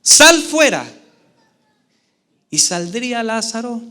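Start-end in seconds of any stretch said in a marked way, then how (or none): 0.0-0.9 loud, high, booming command; a ringing order thrown toward the tomb; commanding with authority. Retold or imitated. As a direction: call the dead man out with full authority. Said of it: "con autoridad".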